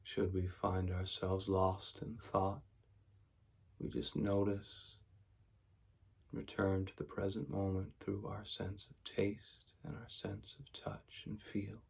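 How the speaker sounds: soft, even meditation voice with long pauses; quiet, unhurried mindfulness guidance; guided meditation. Real, not acted. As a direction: guide them gently into stillness, leaving space after each phrase.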